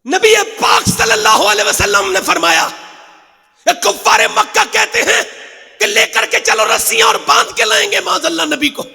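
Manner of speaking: loud, high, driving sermon delivery; a fiery preacher calling on the faithful; full-force preaching. Acted. Preacher builds the battle story.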